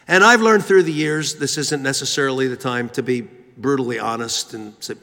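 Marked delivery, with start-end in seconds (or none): none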